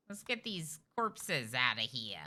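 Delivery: ordinary delivery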